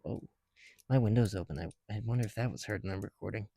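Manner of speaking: normal talking voice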